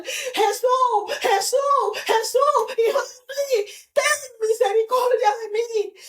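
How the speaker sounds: high-pitched pleading cry. Acted. Blind Bartimeo calling to Jesus for mercy, crying out. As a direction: call out high, begging for mercy. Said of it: "empezó a gritar".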